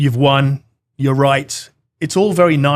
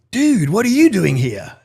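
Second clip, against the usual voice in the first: higher-pitched impression of an old surfer; 6 semitones higher than usual. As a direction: greet him like a surprised surfer dude. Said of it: "looks like an old surfer".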